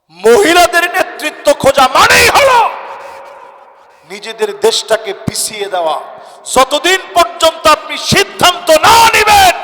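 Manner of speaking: high, loud bursts with a drop between; rousing sermon cry; forceful preaching. Real, not acted. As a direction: preach it hard, surging up on the key phrases.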